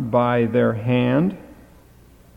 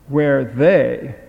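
they leaned on, where they; stress on they; 3 dB louder than usual. Shown as a demonstration. Teacher showing the class where the Hebrew accent falls, stress on they. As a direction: hit the word they. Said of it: "the accent is on they".

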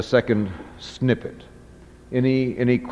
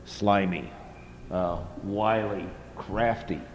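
softer, sly and slimy; 3 semitones lower, 11 dB quieter than usual. Shown as a demonstration. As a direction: let the words slither out, softer. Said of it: "the opposite of wise is not only stupid".

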